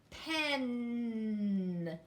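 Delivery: Pen, the final N held long; held ending sound. Shown as a demonstration. Teacher to class, holding the last sound. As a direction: linger on the N of Pen. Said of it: "hold the ending sound".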